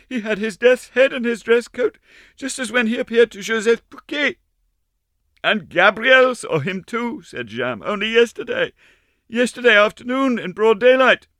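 put-on high, breathless child's voice; frightened little girl. A narrator's reading. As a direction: tell it eagerly and scared. Girls and Jeanne, high voices.

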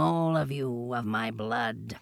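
low, soft, slow panther voice; slow, quiet panther menace. A narrator's reading. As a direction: speak slow and low, like a big cat.

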